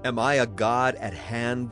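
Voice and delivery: plain reading voice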